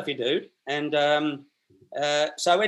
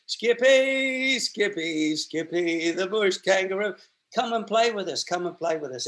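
put-on kids calling out; 3 semitones higher than usual. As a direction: chant his nickname across the street. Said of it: "kids calling him over".

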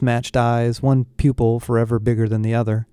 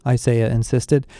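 plain reading voice

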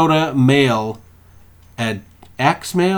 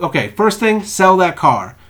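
ordinary talk, nothing marked

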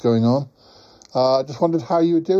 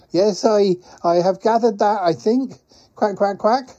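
higher character voice, marvelling at the place; chatty quacking creature; 9 semitones higher than usual. Acted. Quacking traveller tells Paul about talking bears, high chatty creature voice.

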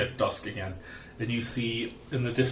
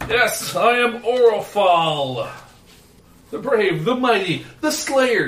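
booming arrogant alien overlord; 10 semitones higher, 12 dB louder, slower than usual. Acted. GM voices drunk elf boasting.

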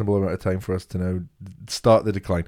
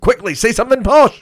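high, loud mock panic; hammed-up urgent squawk; panicked brain; 11 semitones higher, 7 dB louder than usual. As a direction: urge it out like a frantic inner voice. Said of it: "My brain went".

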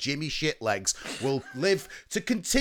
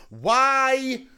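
dramatic outcry; 8 semitones higher, 5 dB louder than usual. Acted. Mock dramatic question.

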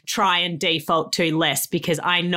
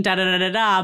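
ordinary talk

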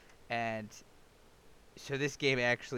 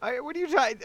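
laughing high squeak; cracking up mid-read; breaking character giggling; 14 semitones higher, 6 dB louder than usual. Real, not acted. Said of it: "I can't do it with a straight face".

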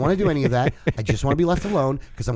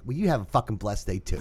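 low, cold sarcastic comeback; sarcastic, quieter; 6 semitones lower, 7 dB quieter than usual. Retold or imitated. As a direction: throw it back softly and sarcastic. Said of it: "And I said it just like that".

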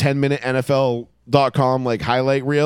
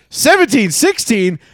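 rising into a shocked yell, much higher and louder; disbelieving cry getting louder at the score; shocked disbelief; 10 semitones higher, 7 dB louder than usual. Retold or imitated.

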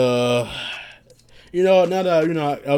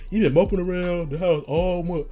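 lightly voiced dad, higher pitch; mock-scold with pitch raised; imitated scolding dad; 4 semitones higher than usual. Dad questions his moping son, higher mock scolding.

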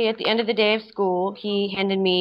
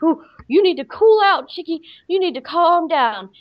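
high, louder mock pep talk to herself; self-scolding; 8 semitones higher, 4 dB louder than usual. In library bathroom talking herself down, high mock coaxing. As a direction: coax yourself like a bossy friend.

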